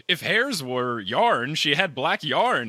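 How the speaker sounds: mock recital. Mocking parody of the line.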